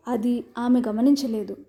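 usual speaking voice

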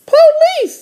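very high little-sister voice. Acted. Little sister reading a sign to her brother, very high voice. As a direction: pitch it way up like a cartoon kid sister. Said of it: "said D W".

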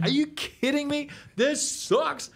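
high, squealing put-on disbelief; mock outrage. Teacher retelling his early frustration, high mock outrage. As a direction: whine it like you want to smash the guitar. His own first reaction retold.

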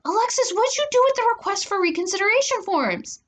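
high-pitched accusing call; angry yell. A narrator's reading. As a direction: yell down the hall, demanding an answer. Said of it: "I yelled".

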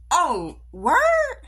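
loud, high "oh, word?"; taken aback and challenging; affronted disbelief. Retold or imitated. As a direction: react loudly, pitch jumping up in disbelief. Reacting to friend's snub.

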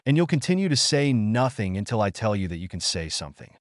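ordinary delivery, nothing marked

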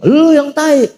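sharp high snapped comeback; retorting insult. Retold or imitated. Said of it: "Apa dia bilang?".

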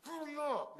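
mock yell of a mom in a rush; mad mom. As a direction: bark it like an angry mother. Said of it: "when your mom gets mad".

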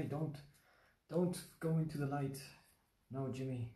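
lightly hammed-up plea to someone straying; bad TV melodrama. Teacher jokingly warning a student off temptation, mock-dramatic plea. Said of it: "That's like a bad T V joke".

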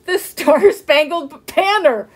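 high, loud, laughter-choked words; gasping with laughter. A narrator's reading. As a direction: push slow words out between laughs. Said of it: "she gasped".

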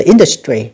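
industry with the first syllable stressed. Shown as a demonstration. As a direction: punch the opening syllable of industry. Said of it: "nhấn âm đầu".

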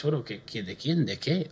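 mock bewildered mutter under the breath; baffled reply. Retold confused reply to question.

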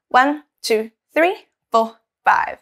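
put-on counting along with a child; teaching a child to count. Shown as a demonstration. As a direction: count aloud for kids to join. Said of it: "let's count".

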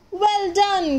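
high bright call of praise. Acted. Park keeper praising the little bird, high call. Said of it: "called Percy".

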